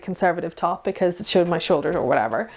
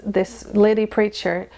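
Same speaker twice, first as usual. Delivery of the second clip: normal talking voice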